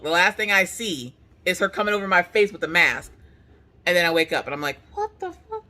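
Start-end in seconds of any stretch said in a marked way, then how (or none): 4.9-5.6 soft, high-pitched disbelief; small high voice of confusion; bewildered waking. Retold or imitated. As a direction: come to, quietly baffled.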